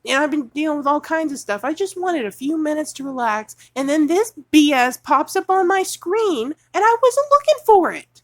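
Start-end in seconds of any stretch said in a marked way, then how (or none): 3.8-8.2 much higher and louder furious rise; real furious outburst; righteous anger. Real, not acted. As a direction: let the anger boil over. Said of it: "this is righteous anger".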